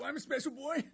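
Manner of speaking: put-on high, rising "special boy"; childish mock whine. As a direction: whine it like a proud little kid. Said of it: "demeanor of a literal eight-year-old".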